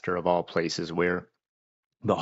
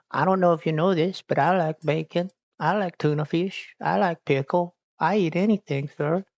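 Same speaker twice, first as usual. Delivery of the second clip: much higher cartoon voice for the cat; cat's voice; 8 semitones higher than usual. Streamer voicing her cat begging for bacon, squeaky cat voice. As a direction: speak for the hungry cat in a squeaky voice. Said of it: "he's like".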